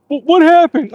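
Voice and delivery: playing dumb. Friend playing dumb, voiced.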